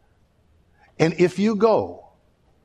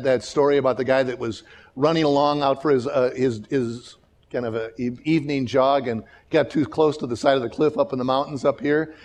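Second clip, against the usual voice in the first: ordinary delivery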